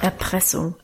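low, understated growl; a low, grudging grumble. A narrator's reading. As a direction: grumble it low in mild protest. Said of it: "knurrte er dabei".

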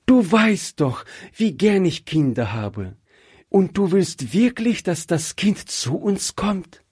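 high-pitched, hopeful excitement; excited. A narrator's reading. Wife asks husband whether he wants the child, excited. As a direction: ask with rising excitement, scarcely believing it. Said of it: "Rebekka war ganz aufgeregt".

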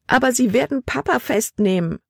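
high, distressed pleading; anxious protest. Acted. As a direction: object in alarm for your father. Girl pleads in fear for father.

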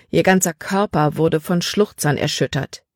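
ordinary delivery

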